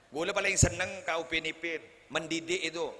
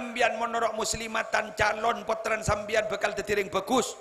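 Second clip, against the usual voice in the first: louder, higher preaching to the congregation; full-force preaching; 7 semitones higher, 6 dB louder than usual. Acted. Preacher urging mothers to join Muslimatan, full preaching voice. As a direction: drive the point home to the mothers. Preaching on choosing a mosque group.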